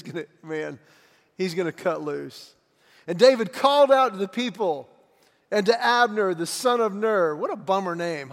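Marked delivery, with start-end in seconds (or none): 3.1-7.4 loud, booming Bible reading; dramatic scripture reading. Acted. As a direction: proclaim the text to the congregation. Reads scripture with full force.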